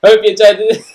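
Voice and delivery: loud, high Jamaican English sign-off. Shown as a demonstration. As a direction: shout the outro in Jamaican English. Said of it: "my Jamaican accent".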